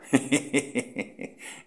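a burst of breathy, airy laughter. Real, not acted. Host laughing at a nickname for a rival, breathy laugh. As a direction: laugh out loud at the joke. Laughs after Cuadri Tesla joke.

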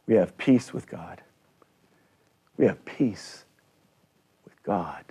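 usual speaking voice

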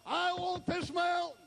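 high preacher's shout; rousing pulpit declaration; bold declaration. Acted. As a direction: claim it in a ringing high cry. Caleb claims his mountain, acted.

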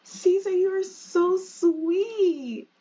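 high, slow, sweet voice; sweet swooning wife. Acted. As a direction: melt at the husband's words, drawn out. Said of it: "And she's like".

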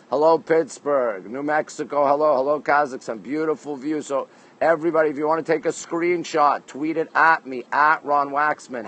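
usual speaking voice

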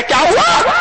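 very high, rising question; mock astonishment. Worshipper sees mosque being washed, mock astonished. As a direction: shoot the pitch right up, incredulous.

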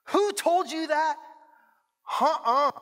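high, slow demand; indignant dad. Acted. Dad demanding who upset his daughter, indignant. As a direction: draw out each word in mounting outrage.

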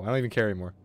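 usual speaking voice

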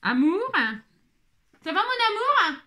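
high call to a loved one; affectionate, high-pitched calling. Real, not acted. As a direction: call across to check on them. Calls to coughing child off room.

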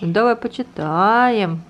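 put-on reading to a toddler.

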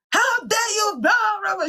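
high-pitched mock outrage of an angry mum; mock angry mother. Preacher imitating the girl's mother, mock furious. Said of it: "She started screaming and all of that".

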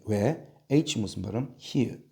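ordinary talk, nothing marked

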